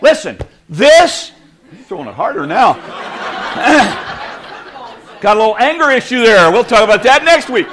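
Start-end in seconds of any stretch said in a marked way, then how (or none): 2.8-4.9 soft unvoiced laughter; breathy laugh. Real, not acted. Pastor laughing at the volunteer's hard throw, soft laugh.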